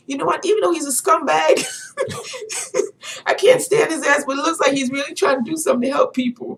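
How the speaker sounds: high-pitched hurried retelling with laughing; imitating excited man. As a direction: act out his worked-up concession. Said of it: "Excuse me if I'm getting excited".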